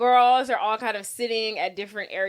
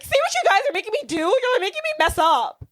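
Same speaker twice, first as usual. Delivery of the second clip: high, fast, whining complaint; whiny blaming jock; 12 semitones higher than usual. Acted. John whines at teammates after losing the ball, high and fast. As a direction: whine it like a sulky kid.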